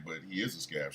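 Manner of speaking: very soft, low husband's reply; quiet acted-out objection; hushed protest. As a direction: murmur it back stubbornly. His meek quiet protest.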